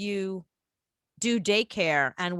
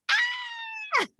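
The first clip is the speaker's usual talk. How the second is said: mock squeal of a running child; gleeful girlish scream. Little girl running and screaming outside for fun, high gleeful squeal. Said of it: "run and be like".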